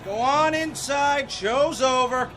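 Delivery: calling out to crowd. Acted. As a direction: send the onlookers back to bed.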